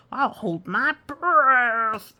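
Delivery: loud slow tantrum voice; stubborn childish outburst. Acted. Temper tantrum turtle.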